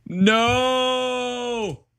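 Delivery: high, loud drawn-out cry of no; long, loud, despairing no; anguished no. Real, not acted. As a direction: howl it as the game dies. Game crashing mid-stream.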